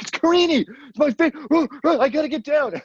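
high, loud and giddy, almost squealing; frantic excitement. Retold or imitated. Rushing downstairs to hear his favorite song, high frantic squeal. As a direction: blurt it out like you can't contain yourself. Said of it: "I was like".

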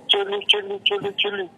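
high squeaky chule call; a silly squeaky put-on voice.

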